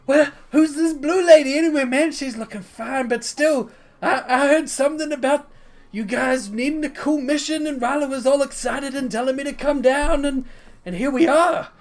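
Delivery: high, animated, breezy ramble; boisterous, chatty Rock figure. Acted. Rock asking Sindolas about the mission, boisterous chatter. Game master plays Rock.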